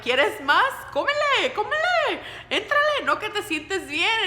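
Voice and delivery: high mocking mother voice, pushing food; mock the sick daughter with food, high and loud; teasing mom in Spanish. Mom teasing her sick daughter about food, high mock voice. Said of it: "And my mom's like".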